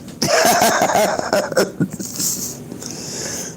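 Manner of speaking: breathy real laughter; laughing. Real, not acted. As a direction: laugh hard at the joke. Laughing at own joke.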